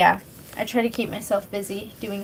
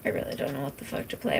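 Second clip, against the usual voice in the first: muttered aside; 7 semitones lower, 12 dB quieter, much faster than usual. Real, not acted. Muttering to herself between chats.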